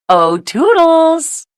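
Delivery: high, slow drawn-out call. A narrator's reading. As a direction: sing out the name to summon. Calling the helper.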